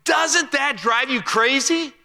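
high, slow, exasperated question; annoyed visitor. Retold or imitated. A houseguest grumbling to the wife, exasperated and drawn out. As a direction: sound fed up with the blaring phone. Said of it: "was getting annoyed and told his wife".

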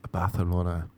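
"Barcelona" as Bah-the-lona; lisped Spanish-style C. Retold or imitated. Correcting a name-dropper, "Barcelona" with TH for C. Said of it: "that's how the Catalan people say it".

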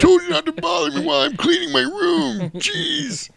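very high whiny nerd; very high, nasal-sounding complaint; Deputy Doofy. Pretend. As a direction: whine like an annoyed dork. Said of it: "I'm talking like Deputy Doofy".

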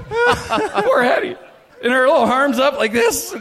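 slow, high words through laughter; laughing. Real, not acted. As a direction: laugh it out helplessly. Laughing at the memory.

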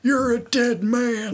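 a hint of the strained wrestler rasp; Terry Funk voice. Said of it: "talking like this".

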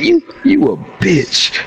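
slow, high mocking insult; drawn-out taunt. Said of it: "I was like".

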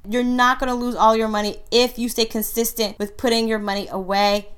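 ordinary talk

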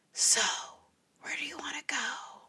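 hushed, breathy imitation of the date; mimicking his low quiet voice. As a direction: whisper it like a soft-spoken guy. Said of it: "he spoke really, really low".

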